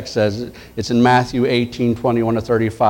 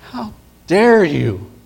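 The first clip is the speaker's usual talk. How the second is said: higher, slow outraged cry; outraged rebuke; 6 semitones higher, much slower than usual. Acted. King crying out at the servant in slow outrage.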